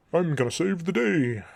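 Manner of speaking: loud, high mock-heroic declaration; hammed-up superhero proclamation; mocking hero impression. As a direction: imitate a self-important hero, big and loud. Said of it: "type attitude all the time".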